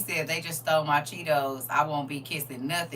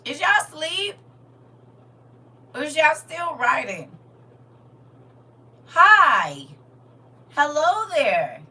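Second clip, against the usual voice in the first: loud and high greeting; yelling; 10 semitones higher, 8 dB louder, slower than usual. Real, not acted. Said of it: "Why am I yelling".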